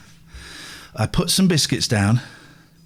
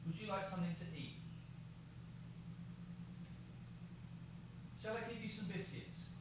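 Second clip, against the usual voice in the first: soft, higher, tender coaxing; cooing to a pet; 8 semitones higher, 28 dB quieter than usual. Real, not acted. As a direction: coax a shy animal, barely above a breath. Cooing to the animal outside.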